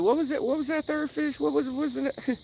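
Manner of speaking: high, fretful mock confusion; high puzzled voice trying to remember the fish; mock confused self. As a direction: play yourself lost and forgetting. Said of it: "I'm like".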